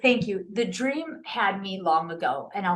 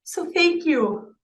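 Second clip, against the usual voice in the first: getting emotional; 6 semitones higher than usual. Real, not acted. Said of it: "I'm gonna get emotional here".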